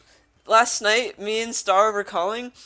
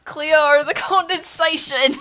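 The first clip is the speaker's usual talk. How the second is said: high, loud, slow through laughter; real laughter in a raised voice; laughing; 8 semitones higher, 11 dB louder, slower than usual. Real, not acted. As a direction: read it out while cracking up. Laughing at an absurd request.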